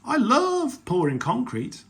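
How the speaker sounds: high, loud, gleeful voice; gushing childish enthusiasm; delighted child. A narrator's reading. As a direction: shout your delight to Dad like a little girl. Peppa shouting her delight to Daddy.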